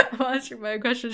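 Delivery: high, giggling through the words; squeaky, helpless laughter while talking; laughing. Real, not acted. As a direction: crack up at yourself. Laughs at her own remark.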